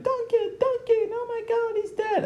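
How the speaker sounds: shrill falsetto mock scream of a name; mock screaming woman. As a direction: squeal it way up high like a panicked heroine. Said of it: "Scarlett Johansson's character was screaming".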